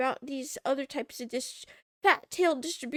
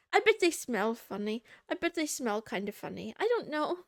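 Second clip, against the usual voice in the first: ordinary talk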